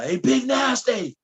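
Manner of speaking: mock shout across the field; retold yell. A young fan calling after him as he leaves the field, with a mild mock yell. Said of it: "And he's yelling".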